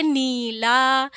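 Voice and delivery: नीला slowly, "neee-laaa"; drawn-out long ee in नीला. Shown as a demonstration. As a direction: stretch both vowels of नीला. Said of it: "बड़ी की मात्रा लगी".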